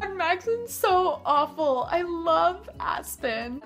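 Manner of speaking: high, dreamy mock swoon; mock lovesick heroine. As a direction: send up a fickle lovestruck girl, slow at the end. Mocking the fickle heroine's whining.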